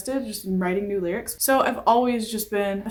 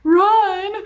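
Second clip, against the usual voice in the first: high, loud cry of dread at running; mock panicked cry; 12 semitones higher, 6 dB louder than usual. Asthmatic kid hearing the gym order, hammed-up anguished cry. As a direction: wail like a kid who can't breathe.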